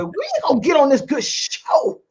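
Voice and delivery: high-pitched comic character voice; Madea. Acted. Host urges the hair doctor onto her show, comic character voice. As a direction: put on a high, playful character impression. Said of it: "like in my Madea voice".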